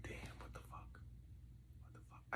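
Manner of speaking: whispered inner thought in disbelief; whispered regret. Retold or imitated. Man looking back at leaving his friend, whispered dismay.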